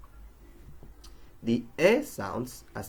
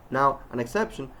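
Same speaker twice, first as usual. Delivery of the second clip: normal talking voice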